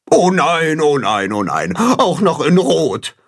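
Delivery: lightly voiced moaning goose; Gram, despondent. A narrator's reading. Goose Gram hears it is red, despondent. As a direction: wail in a falling run of dismay. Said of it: "lässt Gram seinen Kopf hängen".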